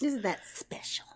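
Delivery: whispered sarcasm. Retold or imitated. Sarcastic echo of villain.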